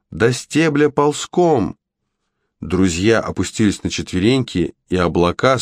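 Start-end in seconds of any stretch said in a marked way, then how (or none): 0.1-1.8 high, slow command; urgent cry. A narrator's reading. Goldilocks orders friends to crawl to the stalk, crying out. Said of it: "крикнула Златовласка".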